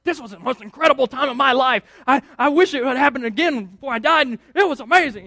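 put-on fast, loud, excited New York accent. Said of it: "they have a New York accent".

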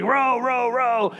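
mock guide yelling from the back of the raft; imitating a guide's shouted commands. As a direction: bark the rowing orders like a river guide. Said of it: "he'd be like".

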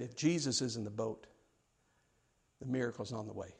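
low, soft, quietly certain; hushed assurance. Retold or imitated. Jim assuring the young preacher about a miracle, quiet and low. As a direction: drop right down and let it sink in.

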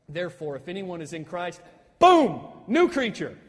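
pause, then blast "boom, new creature"; explosive BOOM. Shown as a demonstration. Preacher quoting a scholar's Greek reading, explosive "boom". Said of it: "the way this reads in Greek".

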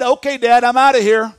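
cocky, loud farewell; brash son leaving. Acted. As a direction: call it out boldly as you walk out on Dad. Acting out son's defiant departure.

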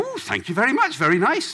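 bright and oblivious; cheery high thanks. Retold or imitated. Pastor happily taking the watch, cheery high thanks.

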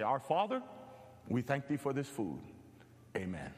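put-on a light take on an old pastor's voice; grandfather's booming prayer. Grandson imitating his grandfather saying grace, solemn and low. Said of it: "he had this old booming voice".

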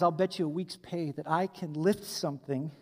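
put-on a quiet, sly old timer; old man voice. Old man bets the young boaster, low and quiet.

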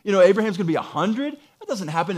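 high-pitched, incredulous protest; scoffing disbelief. Acted. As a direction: pile up objections as if it can't possibly be true. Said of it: "It's being born out of disbelief".

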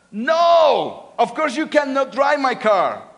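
loud, high stern refusal; firm shouted no. Acted. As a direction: shut the kid down firmly. Father refuses toddler's request.

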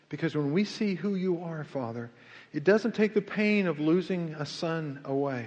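earnest pastoral prayer, rising in force. Real, not acted. Pastor praying for the bowed congregation, earnest. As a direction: pray over the congregation with conviction.

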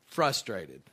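ordinary talk, nothing marked

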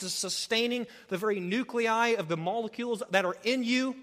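rising fervent pulpit delivery; impassioned preaching. Acted. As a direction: preach it with full force. Preaching at full force.

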